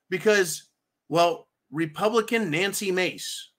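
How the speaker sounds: ordinary talk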